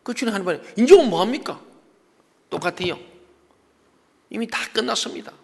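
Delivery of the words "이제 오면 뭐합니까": higher, louder huffy question; mock-sulky complaint at a late arrival; sulky reproach. Sulking sisters greet Jesus who came late, sulky reproach. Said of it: "삐져가지고".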